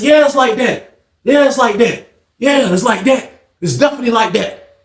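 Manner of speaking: high, insistent comeback; defiant. Retold or imitated. Storyteller standing up to the cheated man, defiant. Acted confrontation, answering a challenge.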